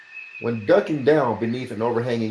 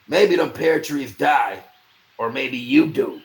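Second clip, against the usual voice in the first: slow, louder, sinister taunt; slow menacing threat; 6 semitones higher, 7 dB louder, slower than usual. A narrator's reading. Bennis threatening the maester, slow and menacing. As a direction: draw it out as a threat. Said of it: "said Bennis".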